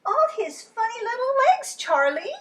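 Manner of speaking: very high, loud delighted squeal; thrilled about funny legs; squealing Lola. Acted. Lola squealing to Charlie, very high.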